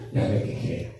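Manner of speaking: whispered aside. Real, not acted. Muttered aside after complaint.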